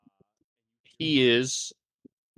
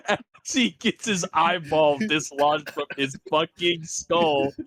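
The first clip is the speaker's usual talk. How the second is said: ordinary delivery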